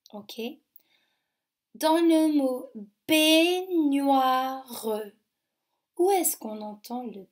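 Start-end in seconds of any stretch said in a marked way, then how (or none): 1.7-4.9 stretched out slowly, "baignoire" drawn out; slow dictation. Shown as a demonstration. Teacher stretching a word slowly for children. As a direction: dictate it slowly and clearly to young pupils.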